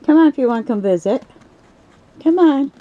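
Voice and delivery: high sing-song coaxing; high-pitched pet talk. Real, not acted. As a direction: coax the snake out, voice up high.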